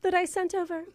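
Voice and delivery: mock-grand British, Frenchified "reservoir"; put-on British accent. Fake applicant asking about her resume, put-on British accent. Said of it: "surprised by your accent".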